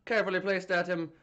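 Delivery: hammed-up Irish storyteller; playful stage-Irish brogue; put-on Irish accent. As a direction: review the film in a mock Irish accent.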